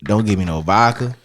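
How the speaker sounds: usual speaking voice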